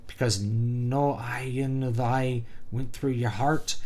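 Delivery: slow, drawn-out Irish brogue; Irish accent. Shown as a demonstration. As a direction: perform it as an Irishman, taking your time. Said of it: "could probably just do an Irish accent".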